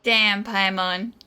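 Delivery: ordinary talk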